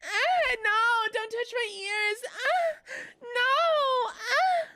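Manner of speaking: very high whiny wail with yelps; squealing mock protest, drawn-out cries; mock panicked pleading. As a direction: wail in hammed-up distress, begging them off. Mock panicked plea over touched ears.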